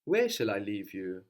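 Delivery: usual speaking voice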